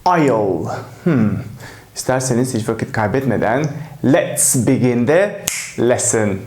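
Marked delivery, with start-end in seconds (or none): 0.1-0.7 I'll said as one short syllable; teacher modelling "I'll" joined up; contracted I'll. Shown as a demonstration. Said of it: "ya da".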